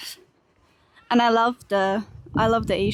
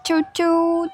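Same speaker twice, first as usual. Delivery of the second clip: playful train noise; 7 semitones higher than usual. Retold or imitated. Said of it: "pretending to be a train".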